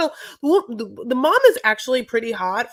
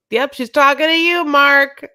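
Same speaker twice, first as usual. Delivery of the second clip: loud, high, hammed-up retort; acted loud finger-pointing; mock blame-passing; 8 semitones higher, 9 dB louder than usual. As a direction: call it out loud and high, pinning it on him. Acted mother passing blame to son.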